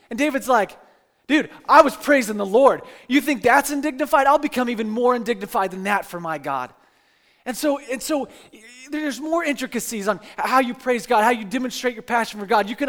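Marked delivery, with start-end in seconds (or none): 1.3-6.7 loud, bold come-back; proud and unashamed, louder; David firing back at his wife, loud and defiant; defiant retort. Retold or imitated.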